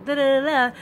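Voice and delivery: high, sing-song playground gloating; bragging taunt. Acted. Winner boasting to the losers, mocking sing-song. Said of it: "brag and boast about it and say".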